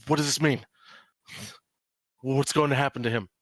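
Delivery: father explaining that his son vanished, mildly upset; lightly voiced distraught father. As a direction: let the worry show, but only slightly. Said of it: "he says".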